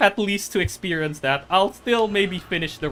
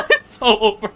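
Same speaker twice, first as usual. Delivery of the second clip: laughing; 3 dB louder than usual. Real, not acted. Laughs at his doomed run.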